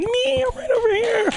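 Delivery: high, far squeaky call from the corner; answering the MC from the back of the room. Retold or imitated. Comedian calling out to the emcee, high and squeaky.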